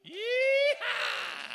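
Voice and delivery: high, loud drawn-out yeehaw; cowboy whoop. Real, not acted. Host cheers on the Southern-drawl singer, high cowboy whoop. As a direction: let out a rodeo holler.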